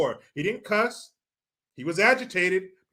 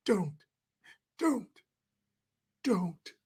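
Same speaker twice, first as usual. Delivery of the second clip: mock feeble pleading; 8 dB quieter, slower than usual. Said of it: "Direct fucking quote".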